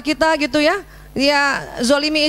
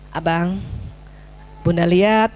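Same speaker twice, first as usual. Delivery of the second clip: lower, gentle calling of the son; soft mother's call; 8 semitones lower, slower than usual. Shown as a demonstration. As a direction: call softly with eyes down. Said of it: "kita tunduk". (panggilan pelan seorang ibu)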